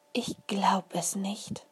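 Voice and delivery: slow breathless whisper of disbelief; child whispering in awe at the beetle; awed whisper. A narrator's reading. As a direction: whisper it, stunned.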